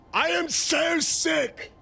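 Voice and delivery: high, slow fed-up shout; exasperated yell. Real, not acted. Streamer venting at chat, slow exasperated shout. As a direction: drag it out, fed up.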